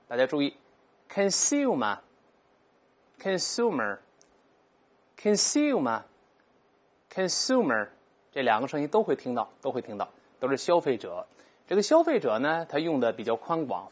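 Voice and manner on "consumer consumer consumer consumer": consumer with oo and the r, drawn out slowly; American pronunciation. Shown as a demonstration. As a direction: model consumer the American way for the class. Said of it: "所以在美音中这么读".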